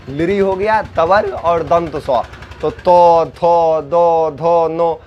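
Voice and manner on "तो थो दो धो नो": तो थो दो धो नो, tongue on the teeth; touch the teeth for तो थो दो धो नो; sound demo. Shown as a demonstration. Teacher demonstrates dental syllables to class. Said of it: "बोलते हैं".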